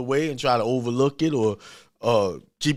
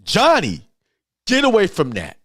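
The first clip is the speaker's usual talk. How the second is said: louder, firm, serious command; sharp warning; 6 semitones higher, 5 dB louder than usual. Shown as a demonstration. Parent sternly warning child at the socket, firm loud command. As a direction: snap Johnny's attention to danger. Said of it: "get his attention and know that you're serious".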